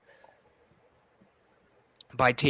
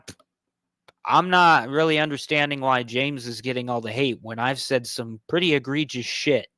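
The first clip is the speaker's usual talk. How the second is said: ordinary talk, nothing marked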